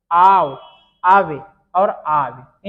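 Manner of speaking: आव called out loud, आवे slowed; teacher drilling sounds; loud drawn syllables. Shown as a demonstration. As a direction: chant आव आवे for copying. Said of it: "इनका उच्चारण कैसे आता है?".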